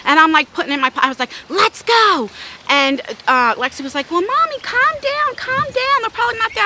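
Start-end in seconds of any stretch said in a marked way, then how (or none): 1.5-2.3 high, loud call to move; fired-up command. Retold or imitated. Arming herself to confront attacker. 4.1-6.6 high-pitched imitation of a daughter; pleading daughter. Daughter pleads with furious mother.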